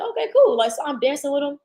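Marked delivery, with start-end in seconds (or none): 0.0-0.6 bright high chirp of agreement, much higher and louder; chirpy okay. Retold or imitated. Squeaky cheerful yes to the tall guy. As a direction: act breezy and sure to the stranger. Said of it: "So I was like".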